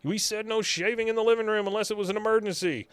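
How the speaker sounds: imitating Luann scolding Griffin; exasperated scolding. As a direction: scold him like a fed-up housemother. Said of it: "She goes".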